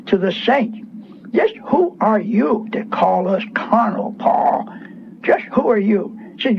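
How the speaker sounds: ordinary delivery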